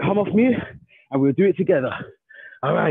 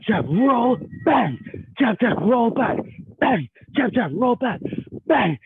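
higher, punchy combo count; boxing coach calling combos; 5 semitones higher, slower than usual. Shown as a demonstration. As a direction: call each punch sharply. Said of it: "we're gonna go for a jab".

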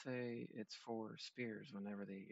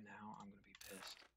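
whisper; 11 dB quieter than usual. Real, not acted. Whispered aside to teammate.

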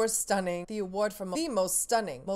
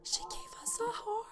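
softer, breathless whisper; awed whisper; 13 semitones higher, 9 dB quieter than usual. Real, not acted. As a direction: hush it in wonder. Awed after the shout.

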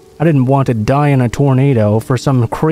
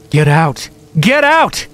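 second much higher, shrieked; shouted over and over; 3 semitones higher, slower than usual. A narrator's reading. Squatter shrieking at the narrator, rising to a high shriek. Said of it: "he shouted over and over again".

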